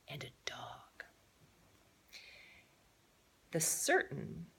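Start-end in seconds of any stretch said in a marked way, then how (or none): 0.1-1.0 whispered aside. A narrator's reading.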